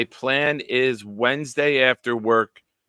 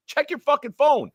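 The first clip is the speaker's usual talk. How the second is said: high urgent call out. Retold or imitated. Host tells the winner to check his phone, urgent. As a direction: tell him to check it now. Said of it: "I'm like".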